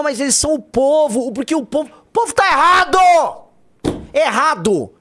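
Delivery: high, loud outburst; outraged shout. Real, not acted. Ranting to co-host about voters, outraged shout. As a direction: shout the verdict, fed up.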